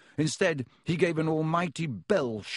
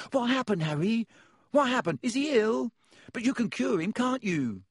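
put-on high-pitched excited child; small boy's high, curious chatter; eager young boy Colin; 11 semitones higher than usual. A narrator's reading. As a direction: pester with breathless-sounding questions, pitched way up.

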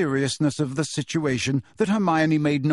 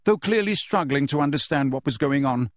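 usual speaking voice

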